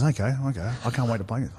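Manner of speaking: lightly voiced Mad Dog, dropped way down in volume; Mad Dog rising to it. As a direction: imitate Mad Dog, quiet and menacing. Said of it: "So Mad Dog goes".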